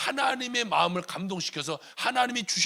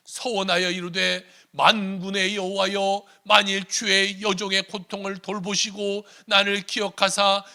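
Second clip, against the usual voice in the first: loud, slow, raised declamatory voice; solemn scripture reading; 5 semitones higher, 7 dB louder, slower than usual. Acted. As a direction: proclaim the vow word by word. Reads scripture aloud. (성경을 크고 느리게 낭독하듯)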